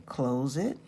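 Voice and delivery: normal talking voice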